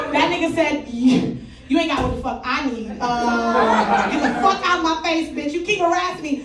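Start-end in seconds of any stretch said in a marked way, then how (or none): none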